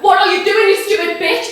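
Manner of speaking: loud, high outraged shout; squealed angry cry. Acted. Man kicked to the floor shouts at girl, high and furious. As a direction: cry out in pained fury. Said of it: "squealed and cried out".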